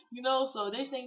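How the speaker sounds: ordinary delivery, nothing marked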